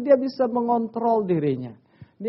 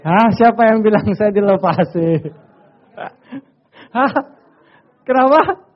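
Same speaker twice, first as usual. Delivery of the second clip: louder, raised mock disbelief; mock incredulous; 3 semitones higher, 9 dB louder than usual. Preacher asking congregation, then playing the officer, incredulous. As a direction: act out the officer's scoff.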